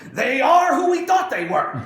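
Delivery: the coach snapping at a reporter's stupid question; furious yell; furious outburst. Retold or imitated. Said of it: "freaks out and loses his mind and says".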